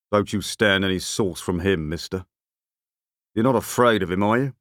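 rough-spoken big man; big beefy man. A narrator's reading. Big man with a glance at the narrator, goading. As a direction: egg a stranger on in a rough voice.

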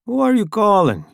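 high, nosy driver voice; pry like a rude driver; Italian driver voice. A narrator's reading. Italian asks.

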